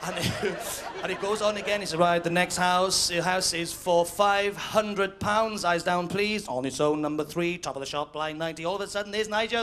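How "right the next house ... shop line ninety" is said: slow, drawn-out caller's call; bingo caller. Acted. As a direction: call the numbers slowly.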